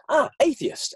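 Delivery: high-pitched, lightly acted "found it"; light mock discovery. Someone choosing an identity label, mock discovery. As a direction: pick it out with a pretend little realisation.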